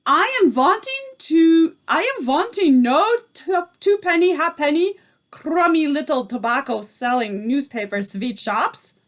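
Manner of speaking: put-on furious accented witch yelling at her followers; shouting Grand High Witch. A narrator's reading. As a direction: bark orders in a foreign witch accent. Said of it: "shouted the Grand High Witch".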